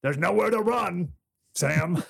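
put-on movie line in a South African accent. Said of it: "with the South African accent".

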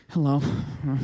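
low, deflated hello; downcast gorilla. Acted. Gorilla picking up on the lady's mood, downcast. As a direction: greet with the energy drained out. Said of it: "energy just comes all down".

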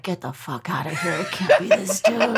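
disbelief breaking into a laugh; stunned then cracking up; shocked disbelief. Retold or imitated. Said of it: "I was like".

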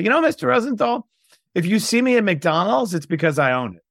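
pitched high, a cocky parting shot; defiant comeback. Retold or imitated. To the teacher who mocked him, a high cocky retort. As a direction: land the comeback with swagger.

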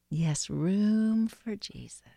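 slow spoken prayer, not whispered; voiced breath prayer. Real, not acted. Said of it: "pray this breath prayer".

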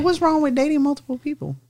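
ordinary delivery

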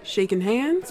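ordinary delivery

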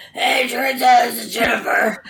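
imitating herself sounding awful with bronchitis; rough, strained sick-caller voice; terrible sick voice. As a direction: croak out a phone greeting, hammed up. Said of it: "you sound terrible".

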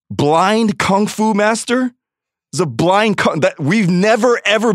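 high, slow disbelief; incredulous. Real, not acted. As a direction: stretch it out in shock. Incredulous at the movie.

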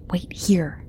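barely breathed words; whisper. Acted. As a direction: mouth it almost silently. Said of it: "Raina mouthed".

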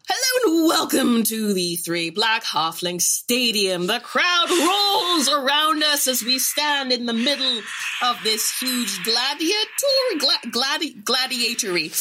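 slow, grand arena announcement; game master's scene setting. Acted. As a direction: set the scene like a stadium herald.

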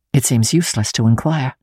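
plain reading voice

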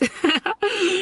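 a light coy giggle. Real, not acted. Laughing at her own sweet act, high giggle.

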